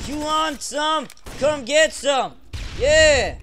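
high, hyped-up battle taunt; taunting yell. Real, not acted. Gamer daring enemies to attack, taunting yell. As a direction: goad them on at high pitch.

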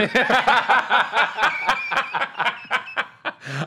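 long, high-pitched belly laugh. Real, not acted. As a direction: crack up at your own joke. Laughing at own envy.

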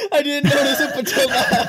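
high, loud, words breaking into laughter; helpless laughter. Real, not acted. Player noticing friend's old message, helpless laughter. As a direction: crack up while trying to speak. Cracking up over late message.

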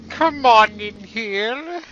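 slow, high spooky invitation; creepy ghost voice. Pretend. Gamer luring Boos in, slow spooky voice. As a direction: lure them in like a ghost.

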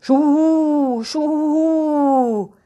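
high, loud, long falling hoots; ringing owl greeting; friendly owl call. Acted. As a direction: hoot like an owl, drawing out the vowel. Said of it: "Eine Eule begrüßt ihn mit ihrem freundlichen Ruf".

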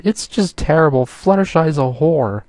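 high, near-sob wail; nearly sobbing. A narrator's reading. Pinkie wails to Applejack about Fluttershy, near-sobbing. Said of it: "Pinkie nearly sobbed".

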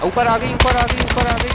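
urgent callout. Real, not acted. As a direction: yell the warning fast. Urgent callout mid-fight.

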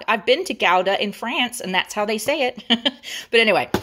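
ordinary talk

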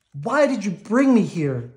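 loud, high yell at the sea. A narrator's reading. Moana yells at the ocean, loud and high. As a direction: hurl the question at the ocean. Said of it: "Moana yelled at the ocean".

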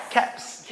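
"Caps" as one-syllable cats; crisp cats for "Caps"; English ts ending. Shown as a demonstration. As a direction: hiss the ts closing "Caps". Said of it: "cat이라고 하지 말고".

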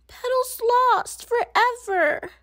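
put-on high distressed child's wail; panicked little girl. A narrator's reading. Wimberly fearing her doll is lost, distressed and high. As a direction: cry out as if the doll is gone.

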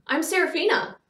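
sudden high, loud burst; blurted out. A narrator's reading. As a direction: blurt it out before thinking. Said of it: "she blurted out".

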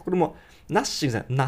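ordinary delivery

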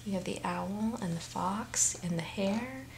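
hushed, secretive listing; soft whispered aside. Real, not acted. Naming the animals to the child.

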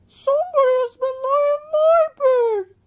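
very high, squeaky mother bear voice; shrill up in the head as the middle bear; middle-sized bear voice. A narrator's reading. Middle bear finds her porridge tasted, very high voice. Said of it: "said the middle-sized bear in her middle-sized voice".

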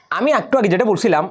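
usual speaking voice

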